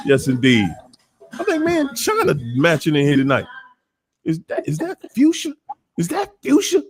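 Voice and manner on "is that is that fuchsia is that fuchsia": mock surprise. Teasing viewer's pink outfit.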